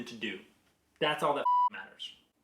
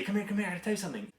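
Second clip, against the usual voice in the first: high, rapid urgent beckoning; 8 semitones higher, much faster than usual. Retold or imitated. Dad calling his daughter to their game, urgent. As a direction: rush it out like an urgent secret. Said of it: "I'd make it very urgent feeling".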